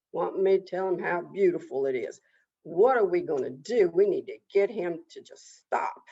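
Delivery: low, fast-paced grumbling; fed-up animals. Acted. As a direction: complain about an insufferable neighbor. Fed-up animals complain together.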